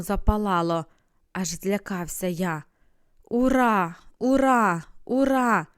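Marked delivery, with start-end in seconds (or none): 3.2-5.7 loud crowd cheer, high and ringing; shouting hurrah. A narrator's reading. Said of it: "закричали". (гучний вигук натовпу)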